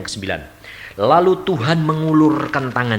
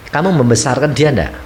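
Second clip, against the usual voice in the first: normal talking voice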